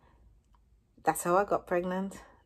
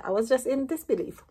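ordinary talk, nothing marked

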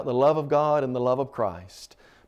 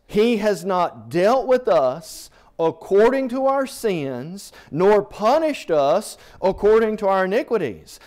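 louder, higher pulpit reading; preaching at full force; 6 semitones higher, 5 dB louder than usual. Acted. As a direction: proclaim the verse to the congregation. Preacher reading scripture aloud.

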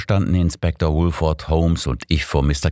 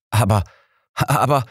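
baffled stammer rising higher; 6 semitones higher than usual. Acted. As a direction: sputter "aber" twice, lost for words. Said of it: "stotterte Inspektor Woolford".

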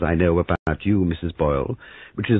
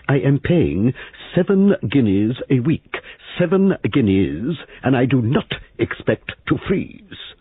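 louder, higher, slow indignant insistence; ruthless; 9 semitones higher, 3 dB louder, slower than usual. Acted. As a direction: cut in hard and demand it. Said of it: "Missus Boyle cut in ruthlessly".

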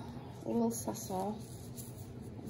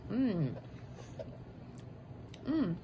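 ordinary talk

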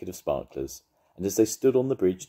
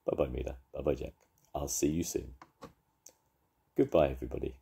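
tender goodbye; 2 semitones lower, 5 dB quieter, faster than usual. Real, not acted. Parent saying goodbye to children.

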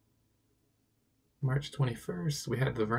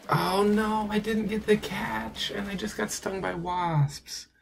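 high, slow, whining lament; moaning after getting stung by wasps, high and slow; dismayed whine; 10 semitones higher, slower than usual. Real, not acted. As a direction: wail it like a small tragedy. Missed catch, stung by wasps.

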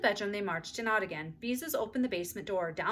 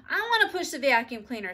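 put-on higher, louder demanding child; screaming; 5 semitones higher, 7 dB louder than usual. A narrator's reading. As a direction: yell your demand. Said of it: "screamed Susan".

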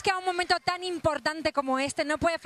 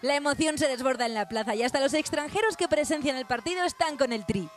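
news voiceover; 6 semitones lower than usual. A narrator's reading. Reporter narrating the match for viewers, news read.

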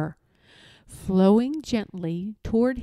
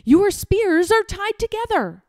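high, loud taunt; gleeful shouted jeer; 11 semitones higher, 5 dB louder than usual. A narrator's reading. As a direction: crow it at the guards. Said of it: "shouted Eric".

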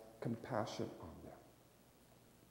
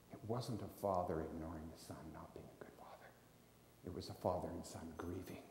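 hushed sermon; 4 semitones lower, 9 dB quieter than usual. Real, not acted. Preacher drops voice after story.

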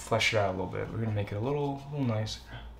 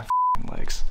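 pained squeal; 6 dB louder than usual. Real, not acted. Legs ache from standing.

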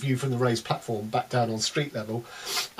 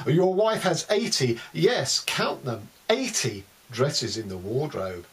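ordinary talk, nothing marked

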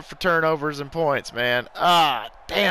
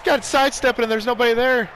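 excited; 7 semitones higher, faster than usual. Real, not acted. Big run by his player.

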